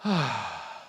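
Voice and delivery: weary sigh. A narrator's reading. Boy on the hilltop, worn out, sighs heavily. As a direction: let a heavy sigh go. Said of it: "There he sighed".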